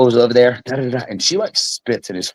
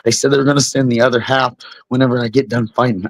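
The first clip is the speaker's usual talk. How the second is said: usual speaking voice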